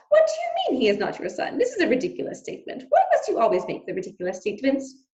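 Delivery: high, indignant French mother; high scornful wife. Acted. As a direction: scold him with exasperation. Antoinette, French mother, scolding.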